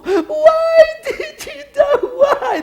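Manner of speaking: very high, stretched wailing cry; over-the-top wail. Acted. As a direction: sob it out like a hammed-up mourner. Mourner wailing, loudest.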